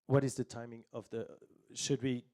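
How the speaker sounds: normal talking voice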